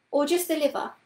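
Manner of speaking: put-on small boy pressing for detail; curious boy. A narrator's reading. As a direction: pipe up like an inquisitive boy. Boy's eager question in story.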